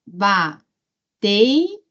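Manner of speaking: "bateia" stretched out very slowly, each syllable apart; very slow syllable demo. Shown as a demonstration. Teacher showing the class the syllables, very slowly. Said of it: "Agora, ba".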